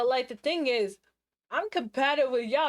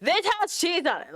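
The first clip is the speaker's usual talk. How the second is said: sassy; 7 semitones higher than usual. Shown as a demonstration. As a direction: throw the complaint at the cashier with attitude. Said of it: "But I'm not like".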